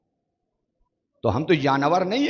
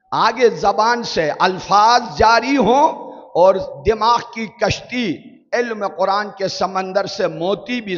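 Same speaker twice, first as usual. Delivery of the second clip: impassioned preaching; 5 semitones higher, 4 dB louder than usual. Acted. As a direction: pour the image out with rising passion. Preaching at full force.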